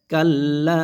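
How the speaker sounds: "Kalla" chanted with a long final "লা"; madd elongation. Shown as a demonstration. Teacher demonstrates the rule, "লা" in "Kalla" held long. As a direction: pull out and sustain the "লা" of "Kalla". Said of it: "লা এক আলিফ টেনে পড়তে হবে".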